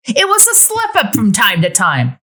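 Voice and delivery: high, defensive character voice; defensive ex-wife. Acted. Ex-wife answers Nina's jab in a high defensive voice. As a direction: pushes back on the old accusation.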